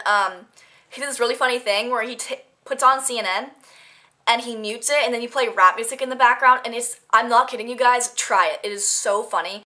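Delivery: normal talking voice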